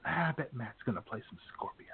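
usual speaking voice

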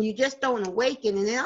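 normal talking voice

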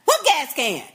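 high, disbelieving what; mock a baffled man, pitch jumping up; incredulous outburst. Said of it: "He gonna say".

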